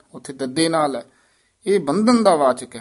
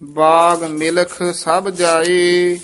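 measured chant with held line endings; scripture recitation; 3 semitones higher, 3 dB louder, much slower than usual. Acted. Teacher models Gurbani for students, measured chanted recitation. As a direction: recite evenly, pausing between phrases.

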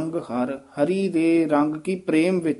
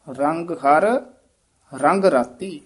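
ਰੰਗ ਹਰ, a long gap, then ਰੰਗ ਰਾਤੀ; break at each unit. Shown as a demonstration. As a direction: halt clearly after ਹਰ. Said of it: "ਹਰ ਪਦ ਤੇ ਠਮਕ ਲੱਗੇਗੀ".

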